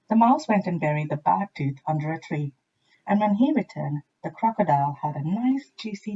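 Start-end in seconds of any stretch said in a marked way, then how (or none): none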